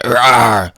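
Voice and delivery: loud, high pretend roar; imitated monster "rawr"; mock zombie roar. Zombie Cap attacking.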